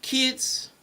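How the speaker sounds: ordinary talk, nothing marked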